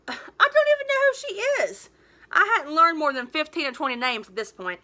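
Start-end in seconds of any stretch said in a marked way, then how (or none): 0.1-1.9 baffled. Acted. Bewildered narrator answers friend.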